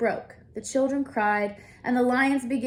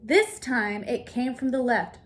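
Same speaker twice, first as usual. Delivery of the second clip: ordinary delivery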